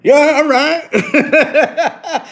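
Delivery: drawn-out laughing "yeah, all right". Retold or imitated. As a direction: give in with a long slow laugh. Laughing, giving in to niece.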